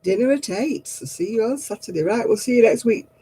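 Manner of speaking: ordinary talk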